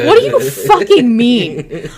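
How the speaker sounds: loud, drawn-out shocked yell. Retold or imitated. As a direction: yell it slowly, stunned and incredulous. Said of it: "I genuinely was in shock".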